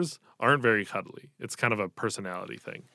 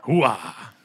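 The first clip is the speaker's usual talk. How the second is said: loud gung-ho Pacino bark; Al Pacino impression; 5 dB louder than usual. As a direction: belt it like a fired-up Pacino. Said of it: "He's very Al Pacino in it".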